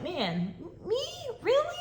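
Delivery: light incredulous why-me; mock disbelief. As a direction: voice jumps up on Really in disbelief. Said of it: "sometimes I'm like".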